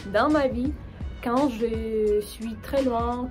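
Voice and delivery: ordinary delivery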